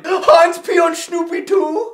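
put-on high, loud, quick question in a German accent; thrilled, incredulous shout; astonished delight. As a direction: squeal it in excited disbelief. Kaiser reacts incredulously.

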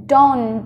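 DON'T with a released T at the end; final T released. Shown as a demonstration. Teacher showing learners the negative, DON'T with popped final T. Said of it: "nhớ bật âm T ở đằng sau".

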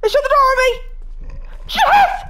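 high panicked screaming; scared horror game streamer. Real, not acted. Ghost slams door, hunting her.

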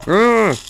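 loud, high yelp; startled scream. Real, not acted. Player startled while looking for ore, loud high scream. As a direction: scream out in sudden alarm. Sudden scare while playing.

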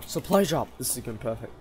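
ordinary delivery

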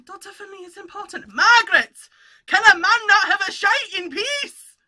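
lightly put-on Scottish accent, rising to a shouted punchline; a hint of Scottish as Dad tells the joke; dad's Scottish accent. Telling Dad's doctor joke in a Scottish accent, shouting the end. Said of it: "he tells it in a Scottish accent".

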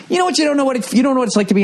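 very high mocking voice; mock them in a squeaky voice; mocking whine. Mocking the parents' complaints.

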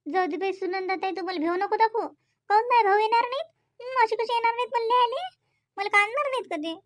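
a worried aunt talking herself into calling Ravi; fretful sister. Acted. To her sister-in-law, a fretful woman insisting her brothers will visit.

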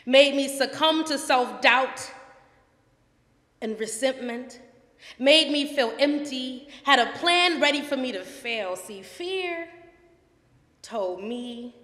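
powerful spoken word performance; spoken word poem. Acted. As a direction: declaim it like a defiant slam poet. Performs her poem Fear.

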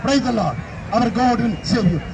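normal talking voice

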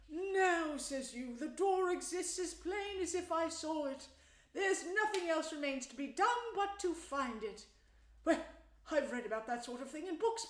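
high, loud, gushing Mole voice; pitched right up, loud and exclaiming; excited storybook Mole. Acted. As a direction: play a small animal gushing with admiration.

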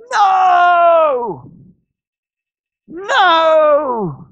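high, long-drawn yell; shouted refusal. Shown as a demonstration. Preacher showing believers how to act on faith, shouted refusal. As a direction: push it back with a big sustained shout.

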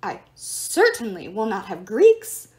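loud, slow, indignant scold. Acted. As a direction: slam each word down like a fussy god. Statue god scolding.

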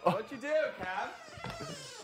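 drawn-out fake cry, slow; mock wail.

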